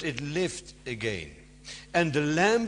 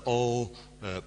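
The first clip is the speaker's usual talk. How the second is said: ordinary delivery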